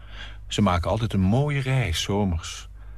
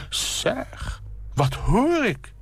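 startled call; much slower than usual. Acted. Nina startled by a noise outside, high cry. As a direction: cry out in sudden alarm. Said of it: "roept geschrokken".

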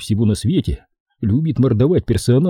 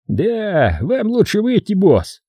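mocking; 10 semitones higher, slower than usual. Jason mocks the boss.

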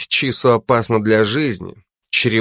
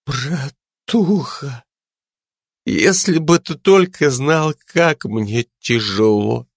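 put-on drunk crying; 4 semitones higher than usual. A narrator's reading. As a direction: sob it out drunk and self-pitying. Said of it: "пьяно всхлипывая". (пьяный плач)

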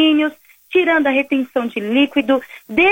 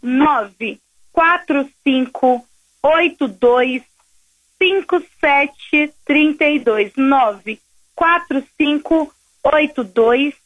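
slow dictation; slower than usual. Real, not acted. Dictating the WhatsApp number slowly.

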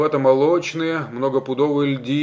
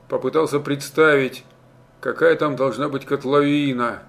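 plain reading voice

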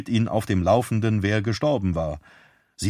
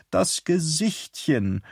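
slow cooing over a puppy; Bernadette's doting coo; 7 semitones higher, slower than usual. A narrator's reading. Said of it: "sagte sie immer".

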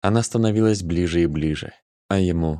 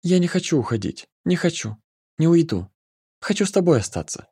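put-on high, pleading girl voice; Nika, pleading; 10 semitones higher than usual. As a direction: beg to stay, voice lifted high. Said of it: "Снова и снова шептала".

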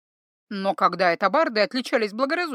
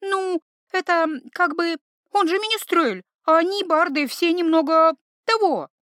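put-on hesitant girl; 8 semitones higher, 4 dB louder than usual. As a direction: fumble for the words. Iva explaining, hesitant.

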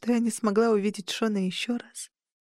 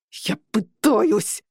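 slow strained growl; 3 dB louder, much slower than usual. A narrator's reading. George snapping back at Sofie, slow growl. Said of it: "прорычал Джордж".

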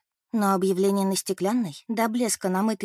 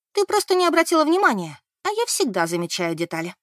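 snorting scoff; 7 semitones higher, 3 dB louder than usual. Acted. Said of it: "фыркнула Бет".

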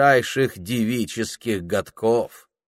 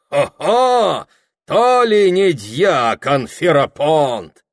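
boisterous exclaiming; 5 semitones higher, 5 dB louder, slower than usual. A narrator's reading.

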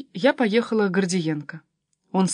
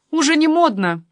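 high, loud riposte; parry her without missing a beat; quick retort; 8 semitones higher, 6 dB louder than usual. A narrator's reading. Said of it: "парировала я". (парируя, повыше)

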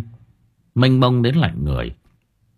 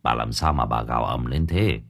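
husband asking; 10 semitones lower, 5 dB quieter than usual. A narrator's reading. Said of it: "ông Sửu nhắc lại câu hỏi".

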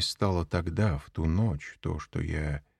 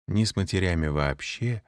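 usual speaking voice

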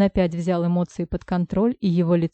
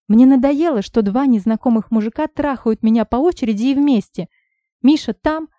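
loud, high furious rant; angry shout; 5 semitones higher, 6 dB louder than usual. Acted. Heroine shouts at both men, fed up. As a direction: throw it at them, fed up.

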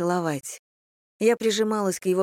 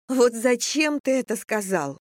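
angry exclamation; 5 semitones higher than usual. Acted. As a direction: exclaim it in playful anger. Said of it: "сердито воскликнула я".

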